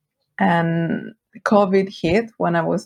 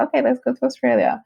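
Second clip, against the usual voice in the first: higher and quick, a spur-of-the-moment decision; quick, higher mock inner voice; excited self-quote; 8 semitones higher, faster than usual. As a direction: toss it off fast, like deciding on the spot. Retelling her own snap decision.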